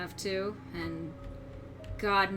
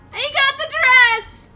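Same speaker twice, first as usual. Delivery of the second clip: high, loud, slow squeal of joy; excited squeal; 14 semitones higher, 12 dB louder, slower than usual. Acted. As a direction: shriek it with delight. Ecstatic about Mettaton's dress.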